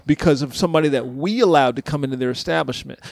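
ordinary delivery

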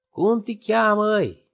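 a slow, high, loud question; a lightly voiced village pastor; simple pastor. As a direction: ask his name slowly, plainly. Simple pastor asks his name.

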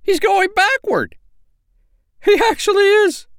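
very high, loud bird squeal; astonished little sandpiper, shrieky and high; crying out. A narrator's reading. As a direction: squeak it in scandalised disbelief. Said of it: "cried Pipsy".

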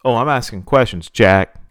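loud, angry challenge; seething. A narrator's reading. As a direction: throw it back loudly, seething. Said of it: "Virgil seethed".

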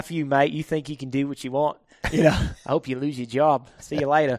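a hint of an angry Australian cop, mildly voiced; mock Australian cop telling the speaker off; Australian accent. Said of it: "Can you do it in an Australian accent?".

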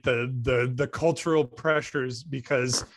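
ordinary talk, nothing marked

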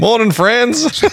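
high, slow friendly call across the trenches; cheery soldier greeting. Retold or imitated. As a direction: sing out a hearty good morning.

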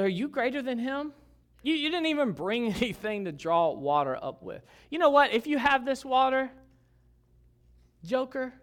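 high, loud mocking challenge; acted indignant, sarcastic retort; bitter sarcasm. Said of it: "Can you sense the bitter tone in her voice".

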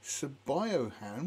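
slow, puzzled "Sabiohan", sounded sa-bio-han; spelling pronunciation. Shown as a demonstration. First reading of the spelling, puzzled slow "Sabiohan". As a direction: sound out "Sabiohan" as written, rising. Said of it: "it's spelt Sabiohan".

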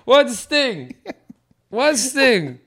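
loud, high mock dad voice; baffled dad. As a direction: play a clueless father, puzzled and insistent. Playing the confused dad at show.

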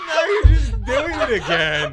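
laughing through the words, slow and high. Real, not acted. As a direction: crack up while teasing her. Teasing friend while laughing.

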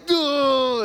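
high exasperated ugh; annoyed driver's groan; frustrated groan. Retold or imitated.

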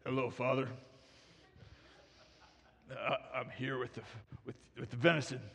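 low, halting impostor voice; Jacob posing as Esau. Acted. Son fooling his father with venison, low disguised voice. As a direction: drop the pitch and hesitate as if bluffing.

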